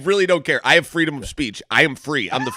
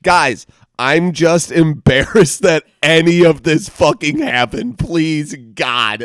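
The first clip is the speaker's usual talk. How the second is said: embarrassed pleading; 3 semitones higher, 9 dB louder, slower than usual. Retold or imitated. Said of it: "she's like".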